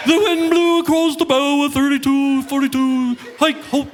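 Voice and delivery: high, loud pompous captain's announcement; ship captain announcement. Retold or imitated. Ship captain waking passengers over the loudspeaker, pompous and loud. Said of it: "captain comes over the loudspeaker".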